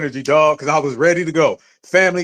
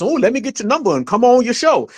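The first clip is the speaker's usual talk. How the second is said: high-pitched mock of a fawning woman; put-on niceness; 7 semitones higher than usual. Woman asking host to come on his show, mock niceness. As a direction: imitate someone acting nice, pitched well up.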